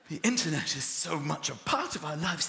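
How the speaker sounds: ordinary talk, nothing marked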